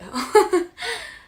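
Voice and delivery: sudden high laugh; burst of laughter. Real, not acted. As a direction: laugh out loud at yourself. Laughing at own answer.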